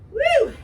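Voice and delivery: very high, loud whoop; excited whoop. Real, not acted. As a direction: shout for joy. Trainer cheering plank finished.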